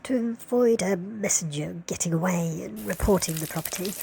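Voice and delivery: ordinary talk, nothing marked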